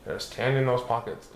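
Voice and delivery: ordinary delivery, nothing marked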